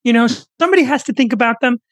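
high, tense lecturing voice; Jordan Peterson impression. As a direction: preach about young men, pitched high. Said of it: "does Jordan Peterson's voice not sound almost perfect?".

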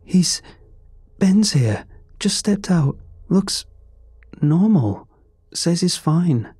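high, breathless, shaken voice. A narrator's reading. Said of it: "higher pitched".

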